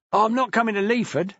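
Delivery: sharp retort; snapping. A narrator's reading. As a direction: snap it back. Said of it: "Annie snapped".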